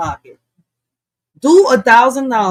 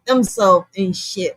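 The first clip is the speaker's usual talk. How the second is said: ordinary talk, nothing marked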